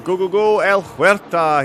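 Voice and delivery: high, loud read-out of a cheer; excited cheer. Retold or imitated. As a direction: belt it out like a cheering fan. Reads out viewer's cheer from chat.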